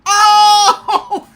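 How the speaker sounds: thrilled yell; excited shout. Retold or imitated. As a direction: roar like the crowd. The theater reacts to the cameo.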